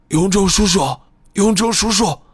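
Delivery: calling out with a voice already gone; hoarse fading cry. A narrator's reading. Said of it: "木武的声音变得越来越轻微，也越来越嘶哑".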